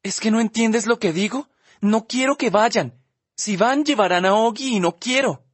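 lightly voiced teen girl near tears; upset girl. Olivia begging Justin to understand, upset.

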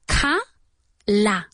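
"Ca- la" split, both vowels open ah; two open a sounds. Shown as a demonstration. Teacher breaking "color" into syllables for students, open vowels. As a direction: say "Ca-" then "la" like kah-lah. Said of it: "Como si fueran dos As".

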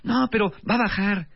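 high, breezy optimist; naive optimist voice. Retold or imitated. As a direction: brush off the worry lightly. Voicing the optimist friend. (optimista ingenuo y despreocupado)

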